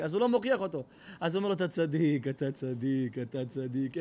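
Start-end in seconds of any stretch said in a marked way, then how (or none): 1.5-4.0 mock flattering rabbi.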